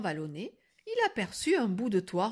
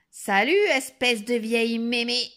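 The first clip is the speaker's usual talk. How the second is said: loud, cheeky shouted greeting; shouting; 3 semitones higher, 7 dB louder than usual. A narrator's reading. Girl barges in on grandmother, insolent shout. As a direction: burst in yelling rudely. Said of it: "entra et cria".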